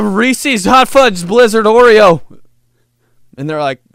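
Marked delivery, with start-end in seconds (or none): 0.0-2.2 panicked loud order. Retold or imitated. Panicked blurt at ice cream window.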